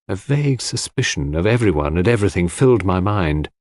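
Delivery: ordinary delivery, nothing marked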